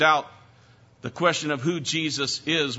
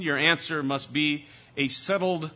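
ordinary talk

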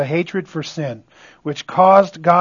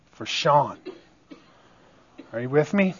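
ordinary talk

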